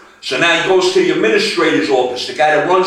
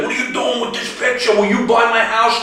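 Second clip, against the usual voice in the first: high, heated threat; agitated threat; 6 semitones higher than usual. Retold or imitated. Daniel Craig threatening the big guy in his room, agitated. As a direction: warn him off, worked up. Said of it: "he gets all agitated".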